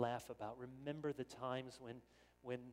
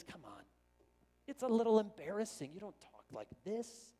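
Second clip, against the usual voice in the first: embarrassed protest; 7 semitones higher, 6 dB louder than usual. Retold or imitated. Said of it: "there's part of us that says".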